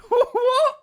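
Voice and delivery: high squealing laugh. Real, not acted. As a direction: burst out laughing. Streamer cracks up.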